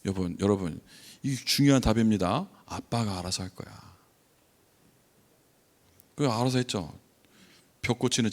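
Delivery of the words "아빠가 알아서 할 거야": low, soft retold fatherly reply; calm reassurance. Dad answers his worried little son, calmly reassuring. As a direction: lightly play the unbothered dad, voice dropped.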